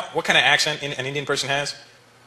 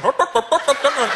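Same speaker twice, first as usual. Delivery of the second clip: high mimicked Indian-accent noises; mock Indian accent gibberish; 12 semitones higher, slower than usual. North Americans mocking East Indian people, caricatured Indian accent. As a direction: imitate a mocked Indian accent in nonsense syllables. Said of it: "people coming up to us going".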